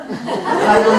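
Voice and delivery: normal talking voice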